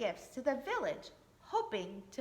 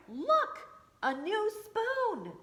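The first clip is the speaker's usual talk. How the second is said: high, louder, slow delight; excited baker; 9 semitones higher, 5 dB louder, much slower than usual. Acted. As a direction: show off the new spoon. Baker shows wife gift.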